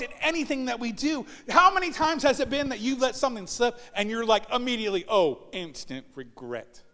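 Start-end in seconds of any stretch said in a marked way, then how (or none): 5.1-6.8 much lower, slow rueful groan; instant regret. Retold or imitated. Someone who let something slip, lowly rueful. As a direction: sink into mock regret.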